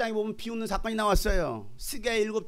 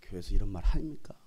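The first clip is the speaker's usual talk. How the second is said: ordinary talk, nothing marked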